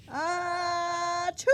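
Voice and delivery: a big cartoon elephant sneeze, ah then choo. Elephant sneezing on his friend, big sneeze. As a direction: blast out the sneeze.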